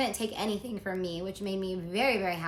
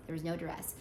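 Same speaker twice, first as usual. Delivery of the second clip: a quiet, lower legal-disclaimer aside; There was no duress said softer and lower as a disclaimer; 4 semitones lower, 8 dB quieter than usual. Shown as a demonstration. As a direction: drop the voice and repeat it for the record. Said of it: "Gotta say that for legal reasons".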